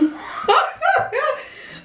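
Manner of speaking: shrieking giggle; high squealing laugh. Real, not acted. Laughs at own confession.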